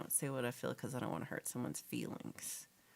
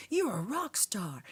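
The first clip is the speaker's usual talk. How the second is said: drawn-out, higher mock hype; hammed-up gushing praise; 4 semitones higher, 4 dB louder, slower than usual. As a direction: act out an over-the-top compliment. Acting out gushing text exchange.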